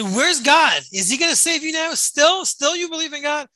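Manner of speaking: high, fast taunting sneer of a guard; mock jeer. Guard taunting the praying prisoner with a high sneer. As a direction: jeer at him, raising it high and quick.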